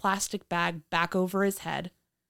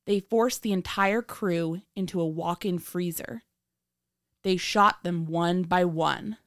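true crime narrator; 10 semitones higher than usual. A narrator's reading.

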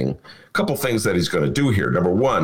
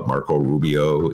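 ordinary talk

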